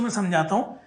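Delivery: usual speaking voice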